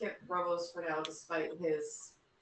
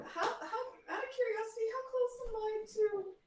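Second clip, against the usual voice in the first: high voice. Acted. Player suddenly talking much higher.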